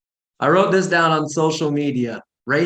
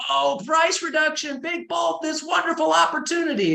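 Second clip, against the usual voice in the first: much higher, near-shouted salesy gush; hammed-up sales hype; 13 semitones higher than usual. Agent announcing a price reduction online, hammed-up hype. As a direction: oversell it like a cheesy ad. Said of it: "But here we are".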